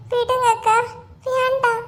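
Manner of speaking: high, whimpery put-on plea; fake tearful pleading. Gita begs her friend not to tell, fake tearful pleading. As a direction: play the wronged victim, tearfully. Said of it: "இது உலக மகா நடிப்புடா சாமி".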